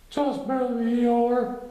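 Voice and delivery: sweet high little bear; high Pooh voice. A narrator's reading. Pooh reassuring Eeyore in a high gentle voice.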